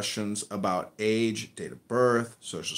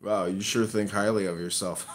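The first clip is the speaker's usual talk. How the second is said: ordinary delivery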